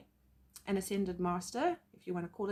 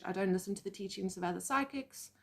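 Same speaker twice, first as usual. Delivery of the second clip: ordinary talk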